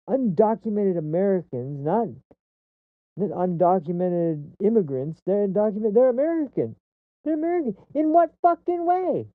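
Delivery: indignation climbing to a very high-pitched cry; incredulous outrage. Real, not acted. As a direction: build to an exasperated, squeaking outburst. Incredulous rant building to outburst.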